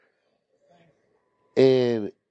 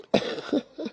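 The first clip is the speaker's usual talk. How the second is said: breathy high giggle; 6 dB quieter than usual. Real, not acted. Cracking up mid-story.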